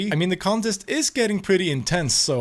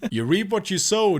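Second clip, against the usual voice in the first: ordinary delivery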